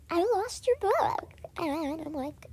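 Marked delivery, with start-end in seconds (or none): 0.1-1.3 higher, louder mock friend voice; lightly voiced fibbing friend; friend's excuse. Friend lying about the book, pitched-up mock. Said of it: "and she, like".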